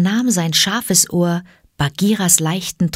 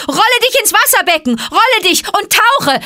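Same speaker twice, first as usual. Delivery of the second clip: urgent, high, loud shouting; fast high shout; loud call; 4 dB louder than usual. A narrator's reading. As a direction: yell instructions to the panther. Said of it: "rief er mit lauter Stimme".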